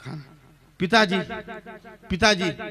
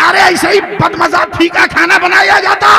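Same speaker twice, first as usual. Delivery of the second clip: mock outrage; 8 semitones higher than usual. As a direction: bark at her over the food. Father-in-law fakes disgust at food.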